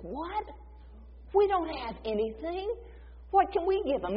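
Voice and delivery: high, disbelieving what; baffled disbelief. Retold or imitated. Disciples stunned by the order to feed crowd, baffled. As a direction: squeak out the shock.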